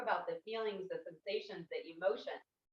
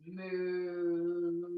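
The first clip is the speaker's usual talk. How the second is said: a lightly voiced low moo; cow moo; 4 semitones lower than usual. Shown as a demonstration. Teacher guides parent and child, a mild moo. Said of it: "cow pose".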